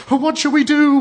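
put-on high, loud childish wail; hammed-up tearful despair; weeping. A narrator's reading. Blue Eyes hearing terrible news, weeping. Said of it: "wept Blue Eyes".

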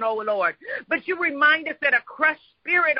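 ordinary talk

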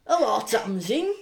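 slow put-on greeting, rising at the end; mock Ivanka. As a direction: draw out a hammed-up hello.

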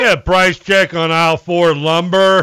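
loud, slow store intercom voice; supermarket announcement. Retold or imitated.

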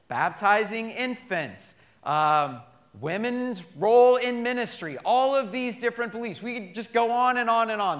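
loud, rising preacher's build; preaching at full force. Acted. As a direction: drive it home to the congregation.

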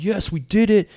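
hammed-up victory cry; cocky cheer. The cocky parents cheering a win, hammed-up. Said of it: "they're all like".

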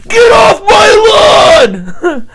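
put-on high, loud old man yell; shouting at trespasser. Impersonating yelling old man.

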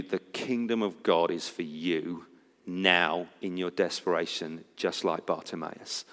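low, hushed pastoral comfort; real, quiet sincerity; tender reassurance. Real, not acted. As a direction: drop low and soft, speak gently to the hurting. Preacher gently addresses those in desperation.

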